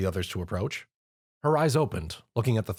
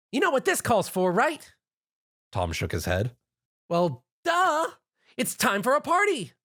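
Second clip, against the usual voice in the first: much higher, bouncy, eager voice; bubbly party pony; 14 semitones higher than usual. Acted. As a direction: gush at the hero you just met. Narrator voices Pinkie Pie.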